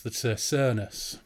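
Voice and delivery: slow, drawn-out Cernus, the O said as a long er; host demonstrating a Hull accent on the band name: Cernus said slowly with an er vowel; Hull pronunciation. Shown as a demonstration. Sonus said as Cernus like a Hull local. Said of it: "a really hardcore Hull person".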